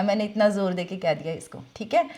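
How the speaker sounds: usual speaking voice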